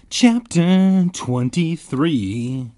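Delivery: high, loud, drawn-out title; dramatic chapter announcement. Acted. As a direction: boom it like a trailer.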